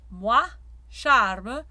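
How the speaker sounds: moi and charme with a bright forward A. Shown as a demonstration. Coach demonstrating the bright A to singers. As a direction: charme with a bright, lifted vowel. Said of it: "really bright".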